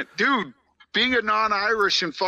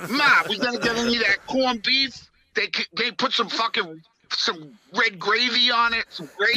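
high, whiny Brooklyn Italian son; mock Italian-American son calling Ma; fake Italian accent; 3 semitones higher than usual.

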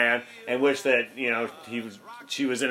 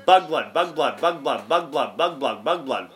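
fast tongue twister. Shown as a demonstration. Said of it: "That's what it always sounds like when I say".

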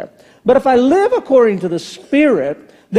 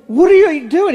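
incredulous; 9 semitones higher, 3 dB louder than usual. Retold or imitated. Confronting dad over the pie.

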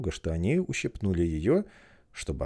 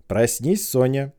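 shouting; 7 semitones higher, 9 dB louder, slower than usual. Acted. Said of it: "закричали все вместе".

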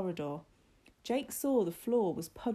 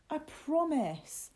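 high, slow, drawn-out assurance; earnest promise; 10 semitones higher, much slower than usual. A narrator's reading. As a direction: stretch it out to convince him. Martin insists he is truthful.

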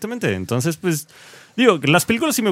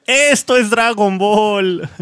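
very slow, drawn-out declaration; drawn-out proclamation; 6 semitones higher, much slower than usual. Retold or imitated. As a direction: stretch each word out slowly. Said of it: "Aplico la de".

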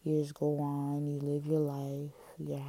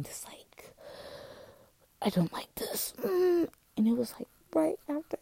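whispering; 13 semitones higher than usual. Real, not acted. Confessing painful memories.

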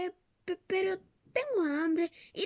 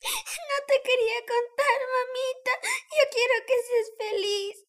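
loud, higher, fast sobbing words; sobbing confession; 6 semitones higher, 9 dB louder than usual. Acted. Girl sobbing as she tells her mother about Víctor. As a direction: blurt it out through sobs.

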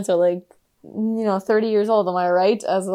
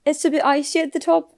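higher voice, imitated Irish-like accent; Cho Chang, Irish-like accent; 7 semitones higher than usual. Her turning around and going, imitated Irish-like accent. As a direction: mimic the film girl's Irish-like lilt, pitched up. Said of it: "That was a really good accent".

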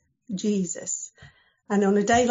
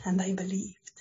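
hushed whisper; 2 semitones lower than usual. Acted. Storyteller whispers the disciples' belief, hushed. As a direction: breathe it out in a whisper.

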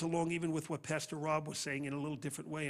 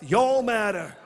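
put-on Southern accent; 5 semitones higher, 15 dB louder, slower than usual. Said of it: "Say it like you're from the South".

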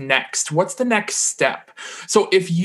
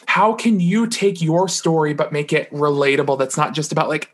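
normal talking voice

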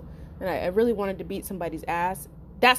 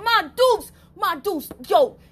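excited call; 7 semitones higher, 4 dB louder than usual. Retold or imitated. Calling to mom excitedly.

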